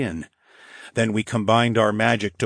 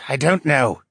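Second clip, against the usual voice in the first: ordinary delivery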